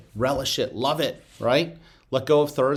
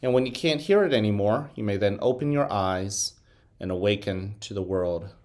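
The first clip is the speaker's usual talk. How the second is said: guided meditation. Acted. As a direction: guide the class into calm, one breath at a time. Leads a meditation.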